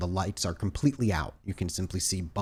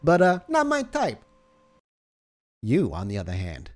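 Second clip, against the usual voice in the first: a higher, fast, smug guard teasing the new nurse; cocky flirting guard; 5 semitones higher than usual. Acted. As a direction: play the sloppy 1950s guard showing off. GM holds the guard's voice.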